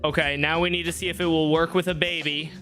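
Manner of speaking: ordinary talk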